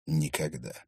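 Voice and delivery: low, soft, final word; confident finality. A narrator's reading. Dagni dreads hearing that word again, quiet confident finality. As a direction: let it drop quietly, as settled fact. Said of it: "произносит с такой интонацией слово".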